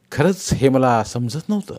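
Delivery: usual speaking voice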